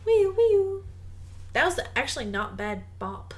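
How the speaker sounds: ordinary talk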